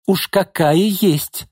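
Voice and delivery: high, slow brazen retort; defiant. A narrator's reading. Mother answering the reproach, defiant. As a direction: throw it back, stretched out. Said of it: "подбоченилась Нина".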